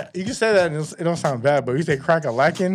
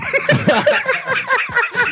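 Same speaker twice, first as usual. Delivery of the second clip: laugh. Real, not acted. Host cracking up at silly slang, high laugh.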